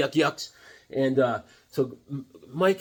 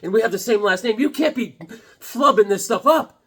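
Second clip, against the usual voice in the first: higher, fast, laughing through it; laughing lecture; 7 semitones higher, 5 dB louder than usual. Retold or imitated. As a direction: scold wife while laughing. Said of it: "I said".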